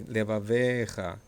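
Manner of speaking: "levavecha" slowly as leva-VEEE-cha; long E, stressed VE. Shown as a demonstration. Teacher showing students the long vowel, slow stretched VE. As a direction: draw out the ve in "levavecha". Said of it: "It's a long ev".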